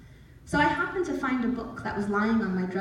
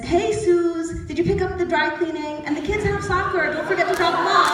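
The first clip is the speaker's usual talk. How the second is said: higher, louder husband rattling off chores; acted husband chatter; 8 semitones higher, 6 dB louder than usual. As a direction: play a husband reminding his wife of errands. Publisher mistakes caller for his wife.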